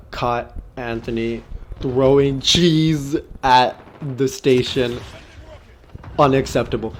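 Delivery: deliberate, word-by-word mock citation; drawn-out read-out. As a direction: stretch each word of a mock official write-up. Reading out the write-up.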